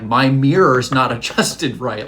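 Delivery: loud, drawn-out mock grievance; amused complaint. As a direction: complain loudly through a laugh. Retold complaint in amused outrage.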